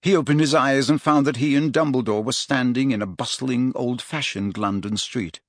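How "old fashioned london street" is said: usual speaking voice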